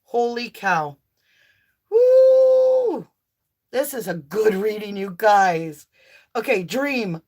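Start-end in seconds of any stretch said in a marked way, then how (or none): 1.9-3.1 long, high, loud joyful woo; excited whoop. Real, not acted. Reader whooping with joy over her best reading. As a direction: hold a soaring whoop.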